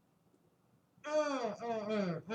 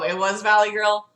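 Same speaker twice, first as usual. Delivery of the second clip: ordinary delivery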